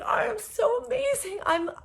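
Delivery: high, loud gushing; giddy delight; elated self-love. Retold or imitated. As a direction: overflow with joy. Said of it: "I was like".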